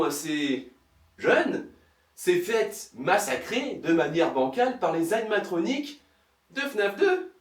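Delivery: normal talking voice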